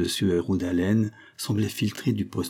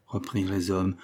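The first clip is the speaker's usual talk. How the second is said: ordinary delivery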